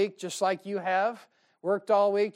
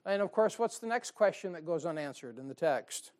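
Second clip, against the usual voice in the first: normal talking voice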